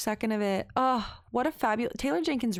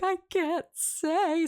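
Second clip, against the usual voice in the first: high, drawn-out playful whine; retold pleading wail, very slow; mock anguished refusal; 10 semitones higher, much slower than usual. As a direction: stretch it out high, hammed-up agony. Acting out refusal to give spoilers.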